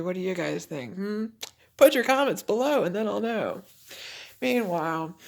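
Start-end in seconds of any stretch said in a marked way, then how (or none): 1.8-3.6 calling out. Real, not acted. Calling out to viewers.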